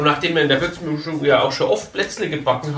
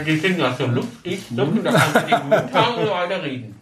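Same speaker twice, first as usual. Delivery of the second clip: chewing while talking; 2 semitones higher than usual. Real, not acted. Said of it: "Ihr seid also auch so ein Schmatz-Podcast".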